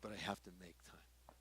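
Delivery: unvoiced whisper to himself; whispered aside. Real, not acted. Pastor confessing his reluctance to the congregation, whispered. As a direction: breathe it as a private admission.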